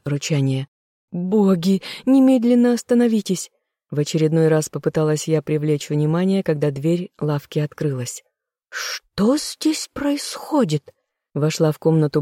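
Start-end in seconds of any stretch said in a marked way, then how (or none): 1.1-3.5 high, loud plea to stop; a pleading high shout over fighting men; desperate shout. A narrator's reading. 8.7-10.9 put-on high, slow old woman's demand; stern old grandmother, slow and high. A narrator's reading. Grandmother enters on the fight.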